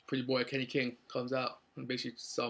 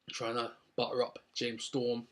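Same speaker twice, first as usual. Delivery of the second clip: usual speaking voice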